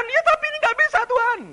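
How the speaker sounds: very high-pitched acted complaint; wailing protest. Believer answering God that it will not work, wailing protest. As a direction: protest in a high, straining yell.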